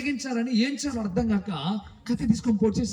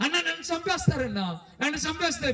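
high, pleading mock cry; acted tearful fear; 7 semitones higher, 3 dB quieter than usual. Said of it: "అన్నా అని ఏడుస్తా ఉండంటే". (భయంతో ఏడుస్తున్నట్టు, ఎత్తైన గొంతుతో)

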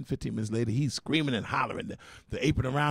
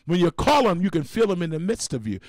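preaching; 3 semitones higher than usual. Acted. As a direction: preach it from the pulpit. Testimony rising into preaching.

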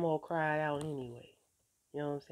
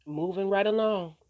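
dismissive brush-off; 4 semitones higher, 10 dB louder than usual. Retold or imitated. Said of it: "Now I'm just like".

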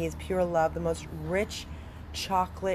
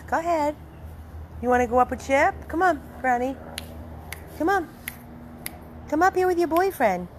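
excited high coaxing; 8 semitones higher, faster than usual. Real, not acted. Owner coaxing her dog up onto the couch, high and excited. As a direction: lure them up with an eager high call. Calling dogs to come up.

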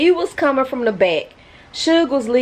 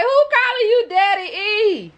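jealous mock question; 12 semitones higher than usual. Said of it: "I'm like".

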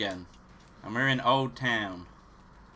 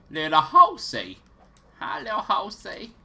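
put-on high-pitched, playful baby talk; playful pet talk; 7 semitones higher, 7 dB louder than usual. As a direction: coo at a cute animal in a high voice. Cooing at a new horse.

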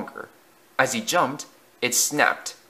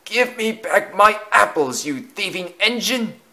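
angry cry; 9 semitones higher, 3 dB louder than usual. Acted. Vicar yells at the engine that took his apples. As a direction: scold the engine that stole your apples. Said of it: "he cried".

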